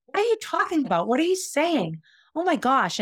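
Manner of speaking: high-pitched incredulous questioning; acting out appalled disbelief, pitch lifted. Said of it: "I couldn't believe what I was hearing".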